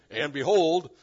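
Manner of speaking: "behold" very loud, stressed on "-hold"; emphatic. Acted. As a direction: proclaim "behold" with force. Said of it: "so emphatic language again".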